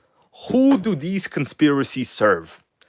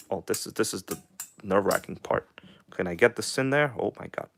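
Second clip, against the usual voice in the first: hushed, nervous muttering; under the breath while straining; tense whisper; 4 semitones lower, 10 dB quieter than usual. Real, not acted. Tense moment fitting a part.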